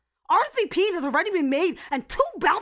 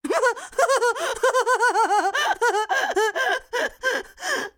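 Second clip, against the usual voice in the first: big laugh. Acted. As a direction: cackle at your own joke. Captain laughs at own joke.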